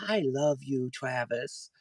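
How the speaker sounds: put-on little boy's words. Little boy hugging the pastor, hushed and shy. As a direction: mimic a shy four-year-old, softly. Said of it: "he leans in to give me a hug and goes".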